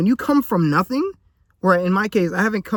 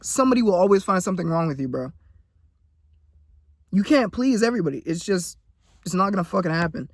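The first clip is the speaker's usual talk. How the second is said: usual speaking voice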